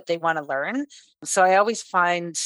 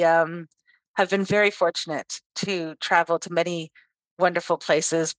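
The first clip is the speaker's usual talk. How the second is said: ordinary talk, nothing marked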